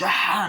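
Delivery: short, high, loud mock scream; imitated scream. They look and see the hands, mock scream. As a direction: yelp as if horrified by what you see.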